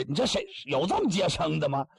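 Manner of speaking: ordinary delivery, nothing marked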